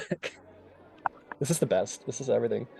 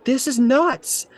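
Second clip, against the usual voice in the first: high, slow disbelief; incredulous; 9 semitones higher, much slower than usual. Acted. Nervous apprentice at a crazy plan, high and slow. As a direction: stretch it out, can't believe this. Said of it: "Abby's like".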